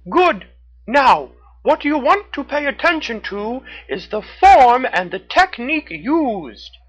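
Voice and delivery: high, loud raccoon call. A narrator's reading. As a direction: shout instructions from above. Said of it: "Red the Raccoon shouted down to her".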